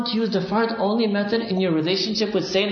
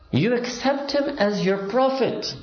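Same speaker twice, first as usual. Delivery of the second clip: drawn out, each word weighed; slow and emphatic; 6 semitones higher, slower than usual. Real, not acted. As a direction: spell it out slowly to the congregation.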